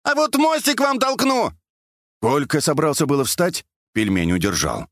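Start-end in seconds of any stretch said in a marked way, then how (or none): 0.0-1.5 high, eager holler; high-pitched calling; shouted reply. A narrator's reading. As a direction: yell an offer across the water. Shouted reply across the water.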